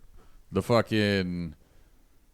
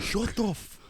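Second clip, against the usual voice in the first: retold angry snap; 12 semitones higher, 4 dB quieter, slower than usual. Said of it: "And I was like".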